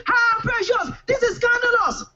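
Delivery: very high anguished shriek; hammed-up heartbroken scream. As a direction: wail at her betrayal at full pitch. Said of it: "I screamed".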